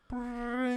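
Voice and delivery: high, drawn-out trilled ring; long high brrring; buzzer imitation. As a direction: imitate a bell held down. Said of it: "it rings in the back".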